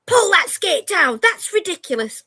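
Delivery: high, loud scolding mum; nagging mum. Retold or imitated. Mum scolding daughter about her skirt, sharp telling-off. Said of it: "I'm that mum".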